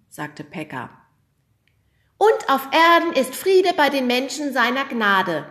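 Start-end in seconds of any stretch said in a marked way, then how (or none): 2.2-5.5 loud, high triumphant proclamation; the angel crows the line in triumph. A narrator's reading. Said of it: "triumphierte der Engel".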